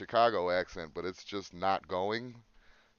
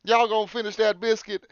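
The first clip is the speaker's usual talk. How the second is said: put-on Southern accent; 11 semitones higher, 7 dB louder than usual. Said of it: "they did use y'all".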